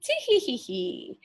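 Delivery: shrill-pitched tee-hee giggle of a witch. Acted. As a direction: titter like a spiteful witch. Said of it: "The witch twins laughed".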